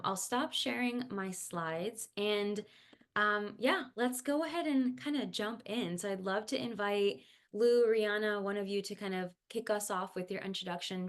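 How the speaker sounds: normal talking voice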